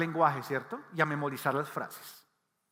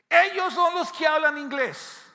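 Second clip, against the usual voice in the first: emphatic preaching; 10 semitones higher, 6 dB louder than usual. Acted. Emphatic point about English speakers.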